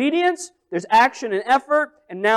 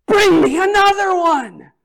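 high, loud, drawn-out call for more; excited call; 10 semitones higher, 8 dB louder than usual. Retold or imitated. Mother thrilled, calling to her sons for another pot. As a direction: ring it out, thrilled. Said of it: "And now she's like".

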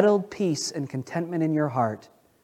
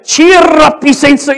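loud, high Scottish brogue with rolled R's; Scottish accent; 11 semitones higher, 14 dB louder, slower than usual. Retold or imitated. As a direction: belt it like a Scottish camp leader, rolling the R. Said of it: "you had to roll the R's".

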